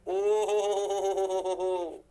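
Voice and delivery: long sustained chant of ho-ho-ho; slow, held "oh ho ho ho"; drawn-out "oh ho ho". Real, not acted. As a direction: stretch the ho's out on one level pitch. Drawn-out exclamation over the board work.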